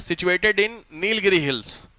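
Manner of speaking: slow dictation. Shown as a demonstration. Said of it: "इसलिए लिखो".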